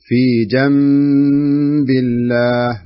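جنب drawn out with nasal m sound; slow nasal ghunna. Shown as a demonstration. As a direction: hold the nasal in جنب very slowly. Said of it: "ما أقول".

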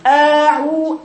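long, loud "أعو-" opened upward and thickened. Shown as a demonstration. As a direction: lift the upper jaw and darken "أعو-". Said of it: "الفك العلوي فوق طالع فوق قوي".

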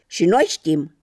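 loud, sour retort. A narrator's reading. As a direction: cut in sourly, not to be outdone. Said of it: "spuseră acru o pereche de galoși".

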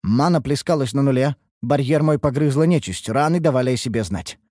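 ordinary delivery

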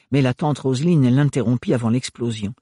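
ordinary delivery

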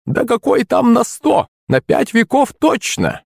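high, slow crone exclamation; delighted old woman. A narrator's reading. Crone marvelling at her supply, delighted. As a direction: marvel in a stretched-out granny voice.

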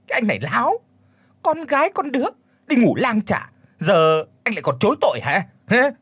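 put-on high, sharp scolding old man; angry scolding. Old man scolds the guilty young man, angry and high. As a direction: berate him for lying, voice up high. Elder scolding the son-in-law.

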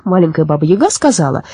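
usual speaking voice

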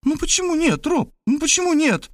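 put-on very high, insistent girlish pleading; bewildered young woman. A narrator's reading. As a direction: ask him why in high-voiced puzzlement. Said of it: "недоумевала она". (высокий девичий голос, недоумённо)

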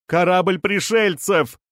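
high, slow-stretched exclamation; crying out. A narrator's reading. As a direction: call it out over a crowd. Voice in crowd cries out.

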